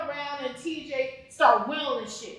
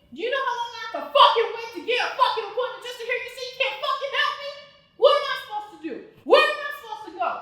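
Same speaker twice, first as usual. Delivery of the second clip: higher and fast, words tumbling in anger; furious pleading; 6 semitones higher, 3 dB louder, faster than usual. Acted. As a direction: pour it out fast at the clerk.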